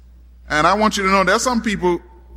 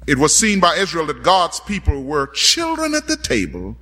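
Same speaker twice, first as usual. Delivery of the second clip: ordinary delivery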